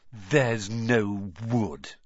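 slow mocking imitation. Ron mocking Hermione's panic, slow scornful imitation. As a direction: mimic her words scornfully.

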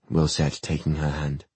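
ordinary delivery, nothing marked

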